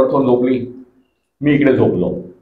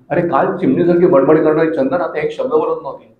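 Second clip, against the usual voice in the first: usual speaking voice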